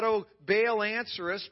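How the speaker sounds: loud, pleading call to Baal; retold crying out. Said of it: "They cried out".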